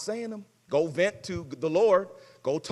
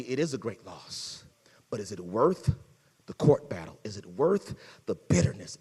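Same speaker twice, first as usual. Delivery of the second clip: ordinary talk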